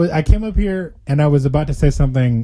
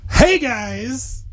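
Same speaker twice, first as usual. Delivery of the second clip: upbeat intro greeting; 7 semitones higher than usual. Acted. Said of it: "That was decent".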